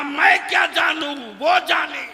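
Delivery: loud, high declamation of a quoted reply; impassioned preaching cry. Retold or imitated. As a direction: proclaim it at full sermon force. Climax of preached story. (पूरे जोश में बुलंद आवाज़)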